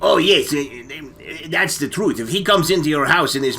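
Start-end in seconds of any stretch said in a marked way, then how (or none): none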